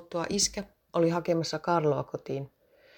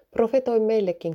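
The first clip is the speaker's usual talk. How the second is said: slightly raised, higher call; calling from the back; 5 semitones higher, 4 dB louder than usual. A narrator's reading. Woman at the back of the hall asks for prophecy, mildly calling out. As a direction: lift the voice a little to carry across a room. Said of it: "kuului silloin naisen ääni salin perältä".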